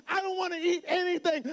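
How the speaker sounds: high-pitched mock tantrum; defiant daughter. Daughter refuses dinner to dad, imitated defiant yell. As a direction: imitate a little girl yelling her refusal. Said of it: "you yell at me".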